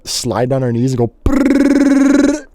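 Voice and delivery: slow, high brrr sound effect; drawn-out high brrr; sliding noise. Retold or imitated. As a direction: make the stair-bumping noise.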